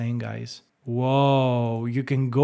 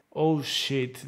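usual speaking voice

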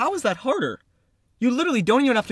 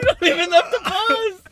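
higher, laugh-choked line; 9 semitones higher, 3 dB louder than usual. Real, not acted. Actor losing it mid-take, laughing. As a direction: try to get the line out while cracking up. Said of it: "I laugh so hard".

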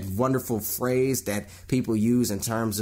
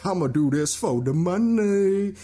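mock greedy actor; 8 semitones higher, 4 dB louder than usual.

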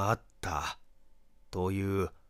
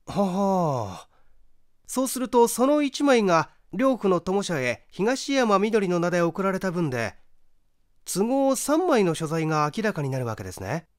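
higher, quick inquisitive male voice; raised, brisk curious young man; young questioner; 10 semitones higher, 6 dB louder than usual. Acted. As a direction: probe eagerly for the answer.

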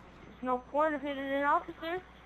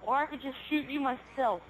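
usual speaking voice

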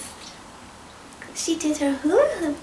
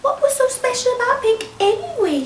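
higher, petulant little poodle; jealous poodle; 8 semitones higher, faster than usual. Acted. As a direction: sniff it out with jealousy. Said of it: "she thought".

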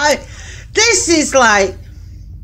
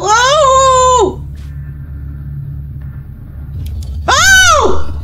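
high, startled yelps; real shouts of fright, pitched right up; 8 semitones higher than usual. Real, not acted. Player jumping at a big spooky shadow, startled shout. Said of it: "sorry for shouting".

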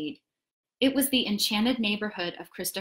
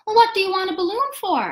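put-on high, quick boy voice; 9 semitones higher, 5 dB louder, faster than usual. A narrator's reading. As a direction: ask brightly like a young boy. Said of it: "asked Christopher Robin".

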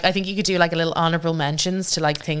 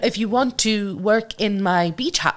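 slow, higher mock foreign accent; [name]; 6 semitones higher than usual. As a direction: drag it out in a muddled celebrity accent. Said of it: "What is your accent?".

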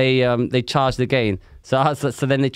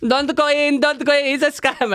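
high, pleading "Don't go in"; a high hint of crying Spaniards; mock crying warning; 12 semitones higher than usual. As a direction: whine the warning like a tearful tourist. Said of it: "crying, going".

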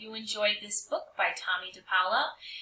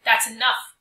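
sharp loud teacher's rebuke; scolding; 11 dB louder than usual. Acted. Teacher scolds Tommy in front of the class, loud and firm. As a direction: cut him off firmly.

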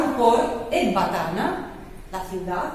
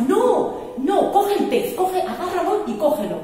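high, loud, laughing commands; Azarías calling out to Tobías to hold the fish, a high loud laughing shout; urgent shout; 8 semitones higher, 4 dB louder than usual. Acted. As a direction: yell at him to grab the fish.

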